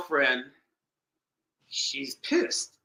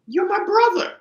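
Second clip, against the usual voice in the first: much higher and louder, a touch mocking; matter-of-fact reveal; 5 dB louder than usual. Sister telling the jealous girlfriend who he is, high and pointed.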